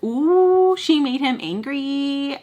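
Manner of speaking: high, drawn-out sing-song "ooh"; mocking taunt. Friends needling a man his girlfriend angered, high taunting. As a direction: tease like an onlooker stirring up a fight.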